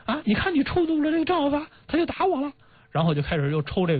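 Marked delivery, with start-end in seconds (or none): 0.0-2.5 much higher, put-on scolding wife voice; shrill scolding. Wife blames the general for her beating, high mock scolding. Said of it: "就骂这个".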